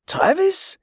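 put-on high, rising, accented cry of recognition; astonished call. A narrator's reading. Pirate recognizes his old friend, astonished call. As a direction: call the name in amazed question. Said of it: "he called".

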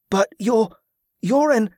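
high, halting, flustered; flustered stammer. A narrator's reading. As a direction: stumble over the words. Jordan stammers before being warned off.

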